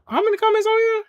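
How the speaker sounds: very high-pitched astonished question; surprised. Real, not acted. Streamer noticing a flood of comments, surprised squeak. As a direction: let the voice shoot up in disbelief.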